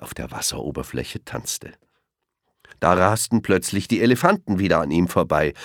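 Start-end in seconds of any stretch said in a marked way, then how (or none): none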